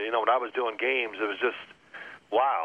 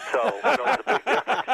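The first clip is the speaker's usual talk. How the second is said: laughing through the words, pitched up; 10 semitones higher than usual. Real, not acted. Laughing at the host's pizza joke, talking through laughter. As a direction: chuckle while you answer.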